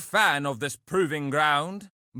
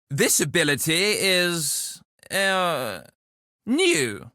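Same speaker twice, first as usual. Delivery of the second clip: searching for the word; hesitant; slower than usual. Acted. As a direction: stall on an "uh" before finishing. Unsure about his new ability.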